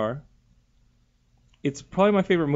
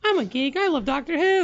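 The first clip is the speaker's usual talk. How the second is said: mocking fake geek; 12 semitones higher than usual. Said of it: "the running joke is like".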